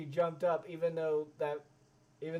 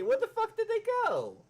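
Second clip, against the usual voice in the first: high, loud outraged yell; outraged shout; 6 dB louder than usual. Real, not acted. Yelling at the screen where the people went, outraged. Exasperated at vanished movie extras.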